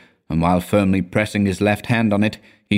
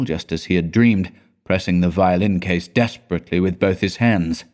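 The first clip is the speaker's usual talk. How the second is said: ordinary delivery, nothing marked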